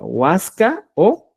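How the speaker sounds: huasca said very slowly and loudly; careful pronunciation demo. Shown as a demonstration. Teacher showing students the correct word, very slow. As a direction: stretch out huasca, o. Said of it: "se tiene que escribir la forma correcta".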